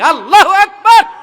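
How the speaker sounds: very loud, high rallying cry; shouted takbir. Real, not acted. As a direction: shout it out to rouse the whole crowd. Rousing takbir to the crowd. (উঁচু গলায় জোরে তাকবির ধ্বনি)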